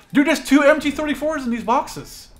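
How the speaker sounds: high, loud burst of excitement; thrilled loot discovery; excited discovery. Real, not acted. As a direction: shout out the find. Excited find in loot boxes.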